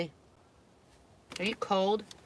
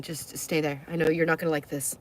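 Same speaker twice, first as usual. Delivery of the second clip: ordinary talk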